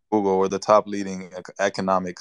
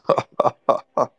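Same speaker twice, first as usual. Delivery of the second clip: short, louder burst of laughter; 5 semitones higher, 4 dB louder than usual. Real, not acted. Debater reacting to being called pathetic, laughing. Mocked opponent in heated argument.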